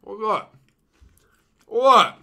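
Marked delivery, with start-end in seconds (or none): 1.7-2.2 surprised. Real, not acted. Surprised by the taste.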